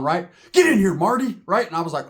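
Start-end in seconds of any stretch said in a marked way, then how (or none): 0.5-1.4 Doc Brown. Retold or imitated. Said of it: "looked like Doctor Emmett Brown".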